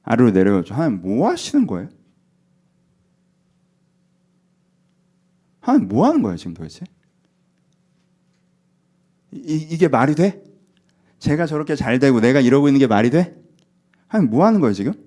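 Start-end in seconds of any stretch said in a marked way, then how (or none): 0.7-6.8 mock indignant challenge with swooping pitch; indignant complaint. Envious believer challenging God, indignant. Acting out someone judging God. 9.3-14.9 acted outrage, louder on the complaint; indignant protest. Envious believer protesting others' success to God, indignant. Acting out someone judging God.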